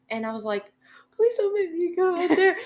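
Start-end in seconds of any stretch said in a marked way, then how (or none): 1.1-2.6 high, loud, crying plea; tearful high-pitched begging; pleading cry. Retold or imitated. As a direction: beg him through tears not to leave you. Begging boyfriend not to leave her.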